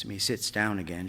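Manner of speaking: usual speaking voice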